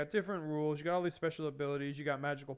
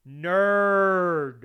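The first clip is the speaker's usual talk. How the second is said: deadpan comeback; 3 semitones higher, 11 dB louder than usual. Retold or imitated. One-word comeback to car guy.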